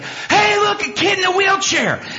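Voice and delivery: put-on high, loud excited call of a kid; excited shout. Son spotting the boy in a wheelchair, excited shout. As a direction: shout it like a thrilled teenager spotting something.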